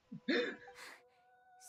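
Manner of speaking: squealing burst of laughter; high-pitched laugh. Real, not acted. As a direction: break into a high, giggly squeal. Streamer cracking up at herself.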